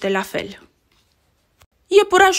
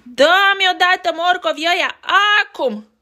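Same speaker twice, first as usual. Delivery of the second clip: bully demanding; 8 semitones higher, 5 dB louder than usual. Acted.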